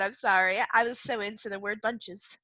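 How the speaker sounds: ordinary talk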